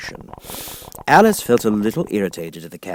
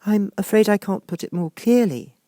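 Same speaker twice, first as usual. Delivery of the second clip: high, lightly voiced polite girl; politely, as a well-mannered child; polite Alice; 12 semitones higher, 4 dB louder than usual. A narrator's reading. Alice explains to the caterpillar, high and polite. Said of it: "Alice replied very politely".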